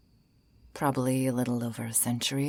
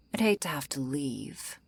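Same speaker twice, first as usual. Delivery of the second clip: ordinary talk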